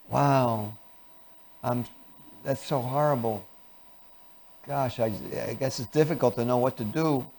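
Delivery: hushed, halting, uncertain concern; acted mild fear. Shown as a demonstration. Helper matching someone in grief, quietly fearful and unsure. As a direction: hesitate softly, as if at a loss. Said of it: "which is fear".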